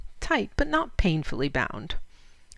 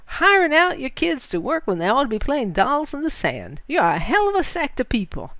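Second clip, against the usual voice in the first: fine contempt; 3 semitones higher, 4 dB louder than usual. Acted. Bandit Hondo Bill mocks Fritz, with swelling contempt. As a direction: sneer down at the foreigner. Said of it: "his voice swelling with fine contempt".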